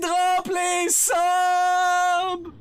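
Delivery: hype shout. Real, not acted. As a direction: stretch it out like an announcer. Streamer hyping a sub drop.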